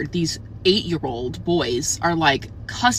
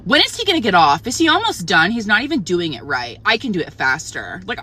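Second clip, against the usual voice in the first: ordinary talk, nothing marked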